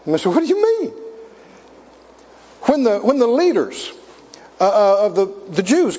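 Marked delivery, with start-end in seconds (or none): 0.3-0.9 a sudden high, astonished "what do you mean"; surprised question. Retold or imitated. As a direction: ask it genuinely taken aback. Retold surprised question to Lord.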